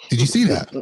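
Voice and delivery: ordinary talk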